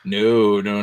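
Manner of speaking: usual speaking voice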